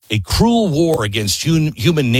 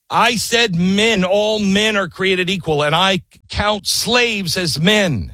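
higher, slow, deliberate declaration; earnest Jefferson; 6 semitones higher, slower than usual. Retold or imitated. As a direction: speak it as Jefferson staring down the king. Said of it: "wants the king to see it and remember".

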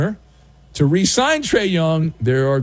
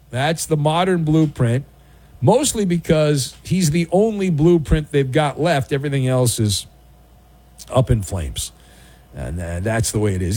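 ordinary talk, nothing marked

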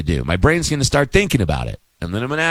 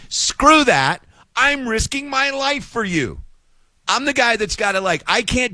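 fed-up outburst; 7 semitones higher than usual. Real, not acted. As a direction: pitch jumps higher, exasperated. Ranting about paying for exit row.

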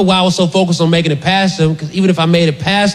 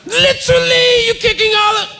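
high, shouted bad British accent; hammed-up British yell; mock British accent. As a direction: yell it like a fussy British guard. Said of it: "I don't do a good British accent".

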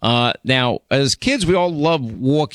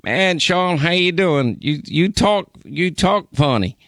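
higher, lightly voiced Southern caller; 5 semitones higher than usual. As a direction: callers from Huntsville, Alabama teasing Sean's accent, in a light Southern voice. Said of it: "every caller would call in".

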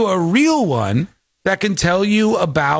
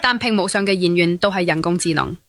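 mock Cantonese. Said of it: "You have a problem with my Chinese accent?".

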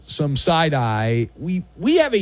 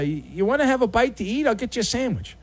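higher, falsely friendly offer; sheepish guy returning lawnmower; 7 semitones higher, 3 dB quieter, faster than usual. Acted. Guilty neighbor offers the owner a sandwich, mock-sweet. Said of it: "asking if".

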